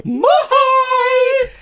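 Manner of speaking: nickname yelled high through laughter; laughing shout. Real, not acted. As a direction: shout the name out, cracking up. Announcing a silly nickname.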